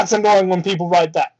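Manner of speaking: ordinary delivery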